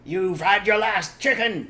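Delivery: loud, slow gloating yell; slow loud high gloat. Acted. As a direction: gloat at the fox. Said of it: "yelled Bogus".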